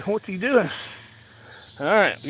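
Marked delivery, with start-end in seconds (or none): 0.0-0.7 higher playful dog voice; voicing the dog. Owner voicing his dog Max watching him work, higher puzzled voice. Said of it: "He said".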